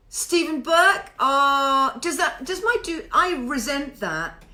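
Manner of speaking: ordinary delivery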